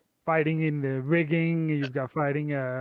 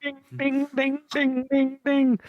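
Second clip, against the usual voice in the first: bell imitation; 9 semitones higher, 4 dB louder than usual. I'm gonna ring the bell.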